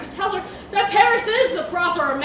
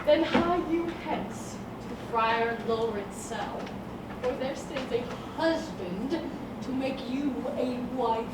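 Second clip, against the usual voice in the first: quiet secretive reveal; hushed; 4 semitones lower, 11 dB quieter than usual. Acted. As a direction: let her in on a secret. Nurse revealing secret softly.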